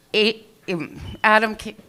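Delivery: ordinary delivery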